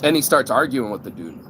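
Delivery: ordinary talk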